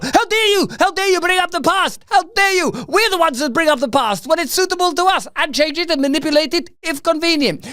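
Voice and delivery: very high-pitched put-on objector; hammed-up falsetto indignation; mock outrage. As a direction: squeal it like a scandalized complainer.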